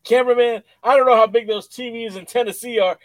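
loud, high imitation of a wrestler; fired-up mock promo delivery; wrestler's promo. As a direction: call out to the cameraman in promo style. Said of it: "he's like".

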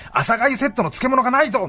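grumpy customer. Retold or imitated. Retold gruff customer.

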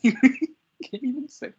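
giggling through the words; words choked by real laughter; laughing. Real, not acted. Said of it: "I can't even say it without laughing".

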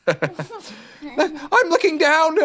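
high, very drawn-out Piglet cry. A narrator's reading. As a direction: stretch it out high, anxiously announcing. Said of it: "cried Piglet loudly".

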